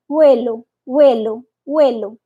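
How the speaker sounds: "vuelo" said as welo; "vuelo" with a w sound at the start; soft v as w. Shown as a demonstration. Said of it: "vão falar vuelo".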